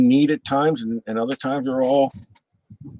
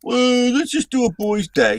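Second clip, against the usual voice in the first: imitating Steve; 9 semitones higher than usual. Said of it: "Steve's like".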